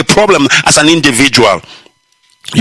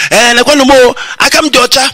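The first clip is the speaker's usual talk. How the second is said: a much higher, whiny, pleading voice; mock complainer; 10 semitones higher than usual. Suspended member moaning to Facebook viewers, mock whine. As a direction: act out someone whining about being wronged. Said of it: "You are complaining".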